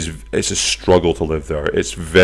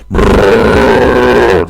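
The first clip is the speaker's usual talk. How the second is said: loud rolled engine rumble, higher-pitched; rough bus engine; 4 semitones higher, 8 dB louder than usual. Acted. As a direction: imitate a rough city bus roaring along. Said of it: "That's how they sound".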